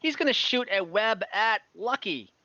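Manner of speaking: ordinary talk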